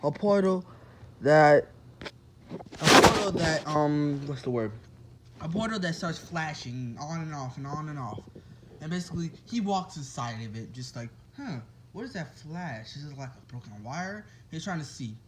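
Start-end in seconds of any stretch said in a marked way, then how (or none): 0.0-1.7 booming dramatic reveal; dramatic loud. Acted. 2.1-3.7 loud, drawn-out dramatic voice; slow dramatic. Shown as a demonstration. 11.4-14.3 quiet. Acted. Deku wonders quietly.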